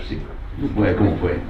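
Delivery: ordinary talk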